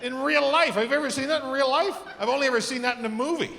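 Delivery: thrilled like it was Christmas; excited. Real, not acted. Excited retelling of pub fight.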